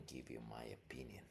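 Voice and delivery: fast hushed whisper. Real, not acted. Whispering his opinion aside to the viewers. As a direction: lean in and whisper it quick.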